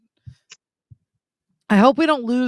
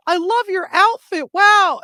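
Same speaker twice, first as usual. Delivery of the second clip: high, loud mock amazement; imitated gushing stranger; 12 semitones higher, 7 dB louder than usual. Stranger admiring her flamboyant outfit, imitated gushing. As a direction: gush like an impressed passer-by, pitched way up.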